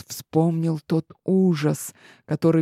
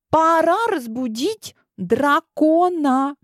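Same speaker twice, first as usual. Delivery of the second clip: put-on very high, slow, thrilled girl; excited exclamation; 11 semitones higher, slower than usual. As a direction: announce it with delighted daring. Clementine's bold idea.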